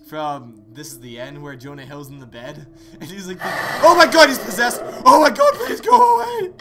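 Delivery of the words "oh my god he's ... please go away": very loud, high startled shouting; frantic, high-pitched begging; panicked scream. Real, not acted. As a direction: shriek and plead as it jumps out. Scare hits during film retelling.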